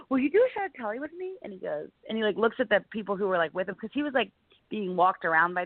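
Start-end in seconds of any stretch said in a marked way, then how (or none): 0.1-1.4 higher, fast eager question; eager ask. Retold or imitated. Asking Warren Sapp to do a shot, fast and eager. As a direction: rush the ask, bubbly.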